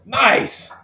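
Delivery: high, loud pleased "Nice."; pleased exclaim. Real, not acted. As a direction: celebrate the right guess. Guessed jersey right.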